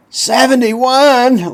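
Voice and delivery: high, slow, stretched gasp of disbelief; imitating the kid's stunned question; shocked disbelief. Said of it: "he said that like, "Oh my God, you're dead".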